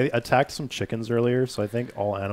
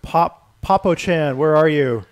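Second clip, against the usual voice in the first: calling out; 4 semitones higher, 7 dB louder, slower than usual. Real, not acted. Calling out to another player.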